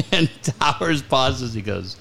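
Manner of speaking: ordinary delivery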